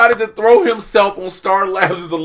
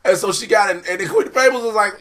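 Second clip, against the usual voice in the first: usual speaking voice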